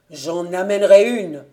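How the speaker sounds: loud, defiant declaration. Acted. Invalid son vowing to bring a wife, defiant. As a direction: declare it at full voice. Furious son defies his father.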